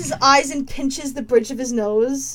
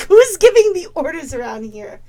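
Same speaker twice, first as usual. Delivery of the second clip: louder, offended demand; indignant challenge; 6 dB louder than usual. Acted. Valeska challenging Falgrim's authority, indignant and loud. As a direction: push back hard on who's in charge. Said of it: "She's like".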